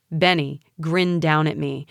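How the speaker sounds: usual speaking voice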